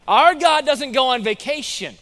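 loud, high proclamation; emphatic preaching. Real, not acted. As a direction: proclaim it forcefully to the congregation. Preacher rebuts the taunt.

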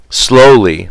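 usual speaking voice

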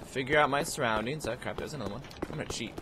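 ordinary delivery

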